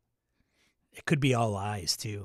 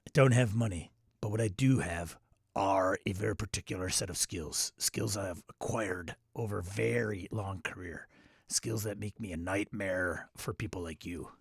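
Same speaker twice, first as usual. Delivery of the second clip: low, soft menace with heavy R's; quiet, measured threat leaning hard on every R; deliberate action-hero monotone, R's brought out; Liam Neeson; 4 semitones lower, 3 dB quieter than usual. Retold or imitated. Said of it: "I gotta really bring on the R's".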